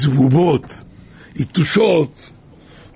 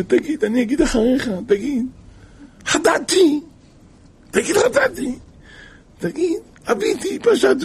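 high, sing-song leading of the confession; coaxing confessor voice; 11 semitones higher than usual. Retold or imitated. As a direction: lead him word by word, sweetly. (קול מפתה גבוה המכתיב וידוי)

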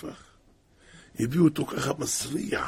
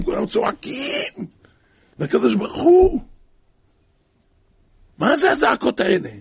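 crying out; 9 semitones higher, 7 dB louder than usual. A narrator's reading. Crowd crying out to God.

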